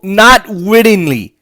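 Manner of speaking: slow, mock-evasive delivery of Not wittingly; mocking quote. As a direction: drag out Not wittingly like a cagey official.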